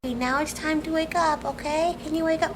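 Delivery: usual speaking voice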